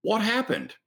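high-pitched imitation of a New England accent; put-on New England accent in a high voice. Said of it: "with her best New England accent said".

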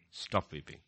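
ordinary talk

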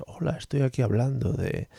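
a hint of a soft Punset voice; Punset impression. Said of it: "como nuestro amado Punset".

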